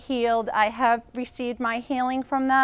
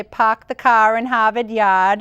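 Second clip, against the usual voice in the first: Boston accent, dropped Rs, a touch louder; 7 dB louder than usual. Shown as a demonstration. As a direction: say it like a born Bostonian. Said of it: "You got that accent still with you".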